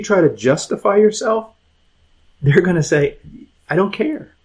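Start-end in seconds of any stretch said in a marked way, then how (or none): 3.7-4.3 higher-pitched brush-off, rising at the end; acted dismissal, pitched up; dismissive. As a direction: wave away the excuse with a lifted CARE. Acted parent dismisses excuses.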